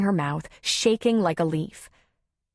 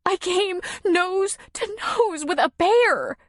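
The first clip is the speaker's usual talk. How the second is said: much higher, slow trembling telling; shaking voice; 12 semitones higher, slower than usual. Acted. Shaken woman tells him about the bear, trembling. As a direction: still shaking from fright. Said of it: "her voice shaking".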